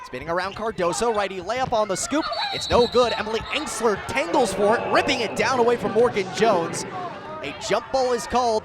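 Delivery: ordinary talk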